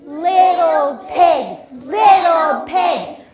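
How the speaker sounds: loud, high, slow wolf calling. Acted. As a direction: call out slowly as the wolf. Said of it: "And he says".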